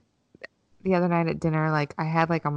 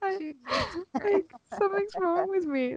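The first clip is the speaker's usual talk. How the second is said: much higher, strained panic; frantic high voice; 14 semitones higher, 4 dB quieter, much slower than usual. Retold or imitated. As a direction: squeak out your own alarm. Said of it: "some kind of mental breakdown".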